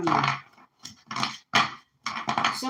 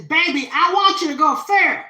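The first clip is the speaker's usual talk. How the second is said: grandma saying "fair", higher and much louder; a proud Southern grandma urging her grandbaby on; loud, high-pitched grandma blessing; grandma's voice; 7 semitones higher, 12 dB louder than usual. Retold or imitated. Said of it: "And she says".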